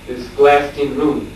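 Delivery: plain reading voice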